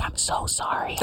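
whispered, almost silent sorry; mouthed apology. Retold or imitated. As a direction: mouth it across the room so only she sees. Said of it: "I'm just mouthing to her".

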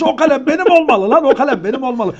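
mock-furious, insist the pen is his; mock angry demand. Fighting a professor for the last pen, mock angry demand. Retold quarrel over a pen.

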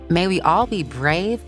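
ordinary delivery

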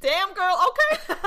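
high, loud cheer of approval; mock-impressed exclamation. Viewer cheering on the drama's heroine, mock-impressed. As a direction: whoop it, pitch shooting up on okay. Retells her reaction to the heroine.

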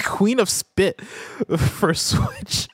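breaking up mid-title; giggled, slow reading; laughing. Real, not acted. Reading funny title, cracking up.

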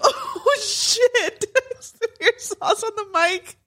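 high laughing exclamation. Real, not acted. Laughing at sauce spill.

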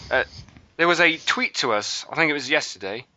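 ordinary talk, nothing marked

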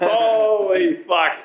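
high, very slow holy fuck; stunned, dragged out; drawn-out disbelief. Real, not acted. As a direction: stretch it out in shock. Repeats shocked reaction.

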